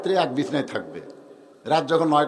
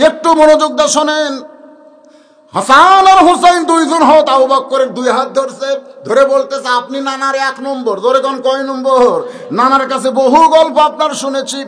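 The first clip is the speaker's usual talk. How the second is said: louder, higher, slow sermon delivery; preaching at full force; 7 semitones higher, 9 dB louder than usual. Acted. Preacher telling the story of Abu Bakr to the crowd, full-force preaching. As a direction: drive the story home slowly.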